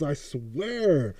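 ordinary talk, nothing marked

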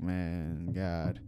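low, soft retold sigh of words; hushed disbelief. Recalling seeing a friend in the casket, hushed disbelief. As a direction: let it sink quietly, low and heavy.

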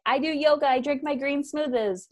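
high, bright mock wellness voice; mocking wellness people. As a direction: parody a smug yoga person. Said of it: "where people are like".

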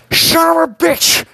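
high, slow pained scream. Acted. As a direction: shriek it out as if hit. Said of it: "he screams as he gets hit".

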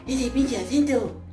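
slow, high mocking Monterrey voice; imitating a regio friend; northern Mexican accent. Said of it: "empezó a hablar como él".

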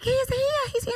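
put-on very high little-boy voice; repeated high-pitched glee; excited child. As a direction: imitate a thrilled kid announcing an arrival. Son excited about elf.

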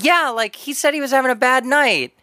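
high imitation of a concerned clerk; sweet shop clerk. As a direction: play the sympathetic counter girl. Voices clerk explaining.

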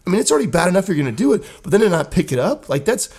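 retold furious tirade at the seat cover culprit; angry rant. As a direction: unload on the guy like you're back in that bathroom. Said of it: "But I wanted to hear, like".

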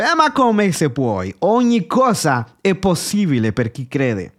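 indignant Italian retort, pitched high and drawn out at first; Gesù as an Italian. Acted. Jesus answers the father's doubt as an Italian would, indignantly incredulous. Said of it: "Se Gesù fosse italiano direbbe".

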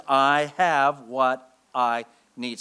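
very slow, firm; slow declaration. Shown as a demonstration. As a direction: shove back with each word. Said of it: "you can shove back with those five words".

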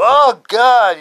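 loud, high cry of horror; acted revulsion. Onlooker sees the hand and turns away, appalled. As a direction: recoil loudly from a gross sight.

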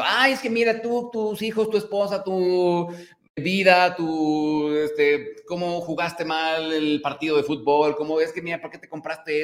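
mock a fault-finding acquaintance listing your flaws; nagging critic. Host imitating a critic who picks apart your family and life, whiny.

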